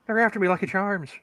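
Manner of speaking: high-pitched stock Irish leprechaun; leprechaun Irish accent. Acted. Friend mimics the cereal mascot to show the accent, leprechaun Irish. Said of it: "the fucking leprechaun action, accent".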